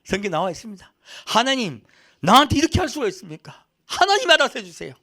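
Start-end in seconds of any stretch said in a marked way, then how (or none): 1.3-4.9 high, loud aggrieved prayer; acted honest complaint. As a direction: protest to God, pitch climbing toward the end. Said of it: "그렇게 솔직히 기도하십시오".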